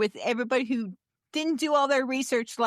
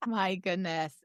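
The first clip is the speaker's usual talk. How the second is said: ordinary talk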